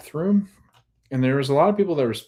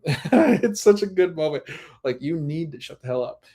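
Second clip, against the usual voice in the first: laughing; 6 semitones higher than usual. Real, not acted. Recapper laughing at Santos's moment, amused. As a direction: chuckle through it.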